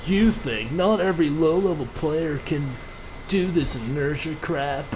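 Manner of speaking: lightly voiced stoner surfer; surfer dude voice. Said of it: "I'm giving him that stereotypical surfer dude voice".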